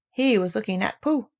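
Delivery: usual speaking voice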